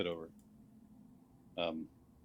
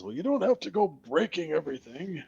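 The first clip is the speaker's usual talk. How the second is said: skeleton voice; 7 semitones higher than usual. Acted. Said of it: "The skeleton says".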